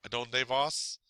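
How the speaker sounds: soft bookish "¿Y tú? ¿A donde vas?"; bookish phrasing. Shown as a demonstration. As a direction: read it like a book, ending on a rising "vas". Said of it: "if you're reading a book, you could say".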